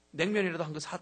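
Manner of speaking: normal talking voice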